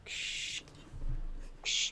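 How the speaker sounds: soft unvoiced shushing waves; shushing sea. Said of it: "Sounds of the, the sea".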